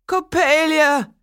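high, loud, slow wail of a name; grief-stricken cry. A narrator's reading. Toymaker finding his fallen doll, high slow cry. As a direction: cry out her name in shock. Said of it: "he cried".

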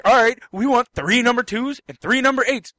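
loud, high, fed-up retort; exasperated ordering. Retold or imitated. Customer answering the yelling worker, exasperated. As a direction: throw the order back loudly.

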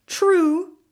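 a king weighing the point aloud; musing. A narrator's reading. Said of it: "the king mused".

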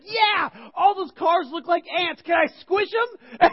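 put-on high, loud, drawn-out kid voice; mock little-boy glee; imitated excited kid. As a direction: yell it slowly for the whole plane. Young son yelling during takeoff.

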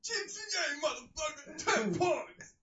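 high, loud mock yell of a film line; quoting a shouted line. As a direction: bellow it like a hammed-up action hero, cracking up at the end. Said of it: "It just always makes me laugh".

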